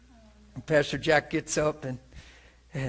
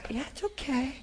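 put-on soothing a baby; 11 semitones higher than usual. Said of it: "patting his little four-month-old".

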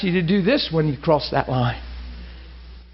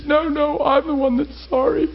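high, breathless mock crying; high-pitched imitation of tearful remorse; retold tearful apology; 12 semitones higher than usual. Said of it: "gasping for breath and crying".

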